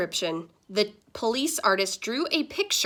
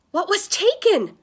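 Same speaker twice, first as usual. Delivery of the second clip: high, louder alarmed gasp; 11 semitones higher, 7 dB louder than usual. Acted. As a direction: ask it with a sharp startled breath. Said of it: "gasped Mom".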